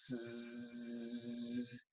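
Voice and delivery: soft, long imitated buzzing; bee buzz. Shown as a demonstration. Teacher imitating bees for the class, soft buzzing. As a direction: buzz like a bee, barely audible. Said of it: "cómo es el sonido de las abejas".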